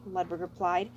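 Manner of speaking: plain reading voice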